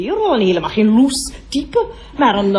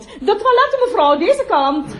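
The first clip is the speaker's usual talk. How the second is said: polite call to a customer; 11 semitones higher than usual. Acted. Directing a customer to the toilets.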